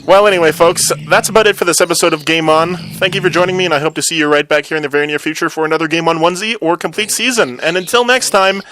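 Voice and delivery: loud, upbeat host outro; show host sign-off. Acted.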